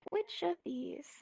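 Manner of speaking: whispered, musing question. Shown as a demonstration. Teacher whispers the choice to the class, musing. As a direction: breathe the question to yourself.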